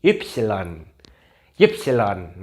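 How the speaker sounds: "Ypsilon" said slowly, ip-see-lon; German letter name. Shown as a demonstration. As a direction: say "Ypsilon" clearly, syllable by syllable. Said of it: "di Jerman bacanya Ypsilon". ("Ypsilon" diucapkan pelan dan jelas)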